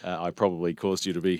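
ordinary talk

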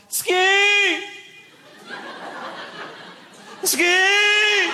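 high, long warning shout; yelling. Acted. Guy on the hill warning others, high yell. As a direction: yell it out down the slope. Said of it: "heard a guy yell".

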